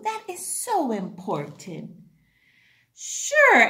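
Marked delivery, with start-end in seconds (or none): 2.9-3.6 high, louder bright sure; cheery sure. Acted. Shallow agreeing with Old Butter, high and bright. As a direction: chirp it happily. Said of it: "answered Shallow".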